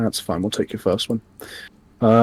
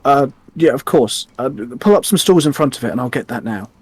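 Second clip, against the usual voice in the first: higher-pitched, hesitant little gnome; gnome innkeeper; 4 semitones higher, 3 dB louder than usual. Acted. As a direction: fumble for words as the gnome innkeeper. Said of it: "the gnome looks over to the".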